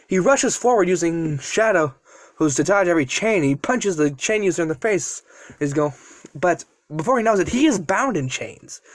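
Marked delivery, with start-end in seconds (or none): none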